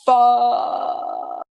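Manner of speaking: long, loud acted curse; drawn-out swear. Chat member reacts to the unmasking, long stunned swear. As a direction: stretch the word out in stunned disbelief.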